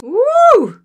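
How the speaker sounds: high, loud whoop; excited cheer. Real, not acted. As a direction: cheer the hero on. Cheering at the movie.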